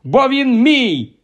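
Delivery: high, slow, forceful angry claim. Acted. Harry angrily insisting the letter is his. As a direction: insist it's yours, angry. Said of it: "розгнівався Гаррі".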